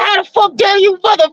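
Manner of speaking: a brother voicing furious disbelief; angry Judah. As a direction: play Judah's offended outburst, hammed up. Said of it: "Judah was like".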